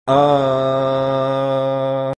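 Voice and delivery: sustained, level tone at normal pitch. Shown as a demonstration. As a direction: hold a steady sung "uh" in your normal voice. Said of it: "from your normal pitch like this".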